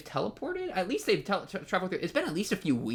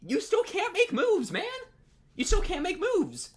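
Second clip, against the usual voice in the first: high exasperated scolding; 13 semitones higher than usual. Real, not acted. Scolding the character.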